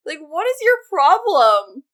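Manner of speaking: mock exasperation. Scolding a TV character for talk of eating turtles, exasperated. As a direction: tell off the absent monster, half amused.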